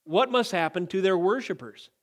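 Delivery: usual speaking voice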